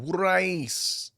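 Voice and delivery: slow, high Philly "wooder ice"; Philly accent. Retold or imitated. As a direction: stretch it like a Philly vendor. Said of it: "They be saying".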